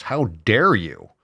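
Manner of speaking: slow, sarcastic how dare you; fake-scandalized gasp of a line; mock outrage. Ranting vlogger mocks critics of grass-fed cows with slow sarcasm.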